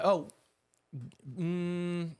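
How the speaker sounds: ordinary talk, nothing marked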